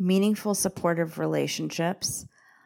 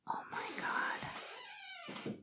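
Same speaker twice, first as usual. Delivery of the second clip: breathy unvoiced exasperated whisper; sighing oh my God under her breath; 18 dB quieter, much slower than usual. Real, not acted. Podcaster exasperated after interruption, whispering.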